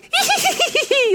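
very high squeaky little giggle; tiny high squeak of a laugh; squeaky chipmunk laugh. Retold or imitated. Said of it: "in this little squeaky chipmunk's voice".

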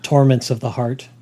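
ordinary delivery

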